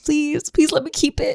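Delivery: high, pleading whine. Retold or imitated. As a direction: beg to keep it. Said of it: "and you're like".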